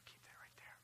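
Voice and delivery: quick unvoiced word to someone nearby; hushed off-mic whisper; whispered aside. Real, not acted. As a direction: whisper it off to the side.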